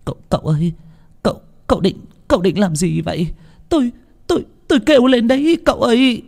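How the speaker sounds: terrified, building to a threat to scream; frightened. Acted. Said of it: "rụt người lại vội nói". (hoảng sợ, lắp bắp rồi kêu lên)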